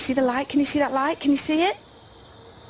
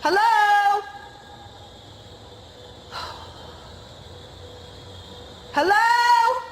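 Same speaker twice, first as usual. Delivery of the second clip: high loud hello into the woods; loud call into the dark; 5 semitones higher, much slower than usual. Real, not acted. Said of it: "Sorry if that's very loud".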